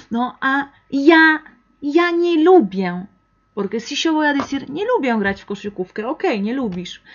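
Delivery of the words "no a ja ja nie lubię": ja said louder and higher; stress on ja. Shown as a demonstration. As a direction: press on ja to insist. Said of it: "poner más fuerza". (énfasis en ja, más fuerte)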